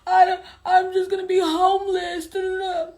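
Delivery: high, loud hammed-up whining; mocking the ex's whiny complaints. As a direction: wail like a pathetic boyfriend. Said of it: "He's like".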